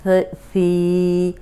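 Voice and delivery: थी stretched very slowly, no whistle; slow soft letter. Shown as a demonstration. Teacher showing the soft थी without whistle. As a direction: breathe out थी softly and slowly. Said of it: "ज़बान रखें तो सीटी की आवाज़ नहीं आएगी".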